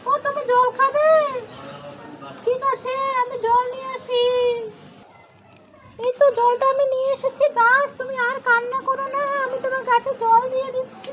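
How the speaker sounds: put-on a small boy gently comforting a sad tree; voicing her little son. Mother as her son, softly consoling a crying tree.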